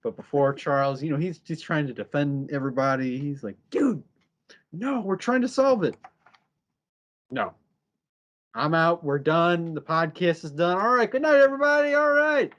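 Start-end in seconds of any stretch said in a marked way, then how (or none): 3.7-6.0 retold high-pitched protest; higher-pitched, insistent defence; mock protest. Charles defending everybody to the others, mock protest. 7.3-12.5 building to high, loud sign-off; retold outburst, climbing higher as it goes; mock quitting rant. Charles quitting the podcast on everyone, mock rant.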